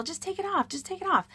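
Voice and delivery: soft, high gentle coaxing; placating husband. Retold or imitated. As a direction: imitate a partner coaxing under the breath. Husband soothes and urges her.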